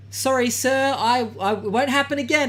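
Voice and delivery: high, stammering mock apology; meek apology. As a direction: play submissive to dodge a fight. Acting out backing down from aggressor.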